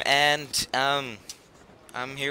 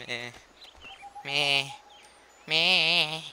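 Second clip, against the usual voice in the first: goofy drawn-out meh, rising each time; a mock-whiny meh; silly meh; 4 semitones higher, much slower than usual. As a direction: play the bored meh for laughs. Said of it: "I do that s-, way too much".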